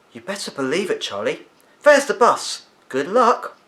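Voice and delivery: a lightly voiced friendly woman waving the boy to his bus; lightly voiced cheery neighbour. A narrator's reading. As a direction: send him off with a bright, lightly acted cheer. Amy Bone calls Charlie off.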